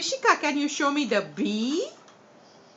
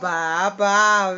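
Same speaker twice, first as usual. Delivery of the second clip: sheep bleat; 9 semitones lower, 4 dB louder than usual. Shown as a demonstration. Teacher imitates sheep bleat.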